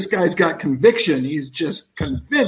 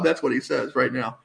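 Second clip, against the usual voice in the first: ordinary talk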